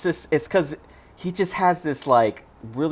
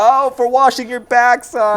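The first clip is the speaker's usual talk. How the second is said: mock yell; 8 semitones higher, 5 dB louder than usual. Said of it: "Yells it out the window".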